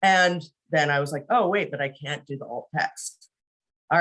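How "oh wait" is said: ordinary talk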